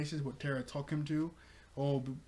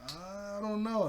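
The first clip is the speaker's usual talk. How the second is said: long, slightly higher hesitant drawl; acted-out reluctant uncertainty; drawn-out doubt; 6 semitones higher, much slower than usual. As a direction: stretch the words out, unsure. Voicing Robin's doubtful answer.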